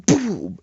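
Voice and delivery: loud, high impact sound; explosive "boom". Retold or imitated. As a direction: shout the blow as a sound effect. Imitated blow with a catfish.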